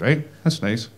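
ordinary delivery

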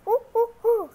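loud monkey hoots; monkey calls. Pretend. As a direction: hoot like a monkey. Child voices toy monkey.

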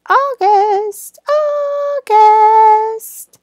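high, slow sing-song; high drawn-out word. Shown as a demonstration.

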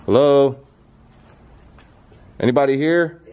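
loud hello into empty room; calling out. Real, not acted. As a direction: call out into the dark.

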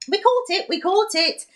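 high, loud gleeful shout; joyful shouting. Acted. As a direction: shout it out in delight, dancing. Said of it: "grinning and shouting".

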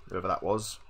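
ordinary delivery

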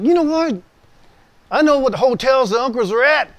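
loud, high, heated retort; angry outburst. Retold or imitated. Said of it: "I'm pissed".

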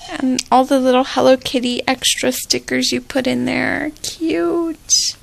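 usual speaking voice

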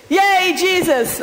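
high, slow cheer, stretched out; loud cheer. Shown as a demonstration. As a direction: cheer it for the child to copy. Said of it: "What are you gonna say to me".

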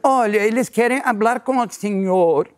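clerk warning. Retold or imitated. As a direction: gently break it to him. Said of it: "Ela falou assim".

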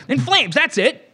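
mock triumphant through laughter; laughing. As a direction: giggle through the punchline.

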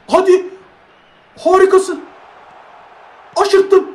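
high, urgent cheer; excited play call. Real, not acted. Gamer urging the players through an attack, excited. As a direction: urge the attack on in high bursts. Calling a rising attack in match.

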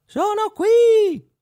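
put-on tiny high-pitched boy's voice, slow. A narrator's reading. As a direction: speak like a tiny boy answering from inside a horse. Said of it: "rispose una vocina". (vocina acuta e minuta)

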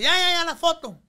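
high, loud mock tantrum. Groom throwing a playful tantrum to get the photo. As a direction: whine it like a spoiled kid demanding. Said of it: "ya hice mi berrinche".

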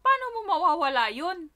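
high loud shocked hala; shocked gasp. Retold or imitated. Reacting to her sister's lost earring, loud shock. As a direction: react aghast at the loss. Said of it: "sabi ko sa kanya".

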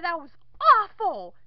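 ordinary delivery

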